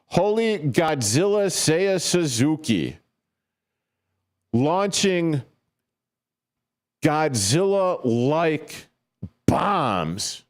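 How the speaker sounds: high, drawn-out hype for Suzuki; hyped announcer. Acted. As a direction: sell the home runs like a monster movie. Hyping the home runs.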